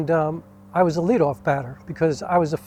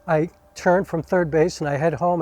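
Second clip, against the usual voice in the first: ordinary delivery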